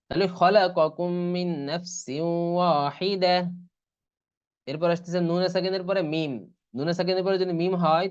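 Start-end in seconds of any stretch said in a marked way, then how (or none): 0.4-3.4 slow, sustained reading of খলাকাকুম মিন নাফসিউ ওয়াহিদা; idgham recitation. Shown as a demonstration. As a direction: hold the nasal n in মিন নাফসিউ. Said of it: "ইদগাম করে পড়তে হয়".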